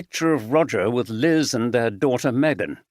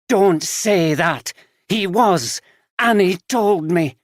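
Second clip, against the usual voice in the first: higher, slow, louder sharp rebuke; angry; 7 semitones higher, 4 dB louder, slower than usual. A narrator's reading. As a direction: cut her off in anger. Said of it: "Liz now looked angry".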